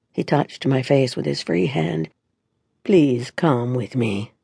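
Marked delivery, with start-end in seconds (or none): 2.8-4.3 low, slow and tender; a gentle, pleading ask; soft plea. A narrator's reading. Lucas pleading softly.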